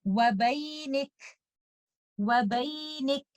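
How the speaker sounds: Wabaynik stopped slowly on a breathy kaf. Shown as a demonstration. Teacher demonstrates the pause on Wabaynik. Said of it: "give hums for letter kaf".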